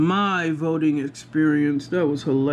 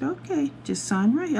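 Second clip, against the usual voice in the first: put-on higher, polite young clerk voice; polite poll worker; 9 semitones higher than usual. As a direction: ask sweetly like a helpful clerk.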